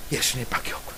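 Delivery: a quiet whisper of surrender; whispered realization. Pretend. Realization voiced as prayer. (예수님밖에 없다는 깨달음을 속삭이듯)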